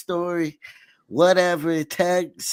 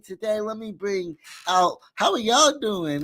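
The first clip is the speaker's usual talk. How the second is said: ordinary talk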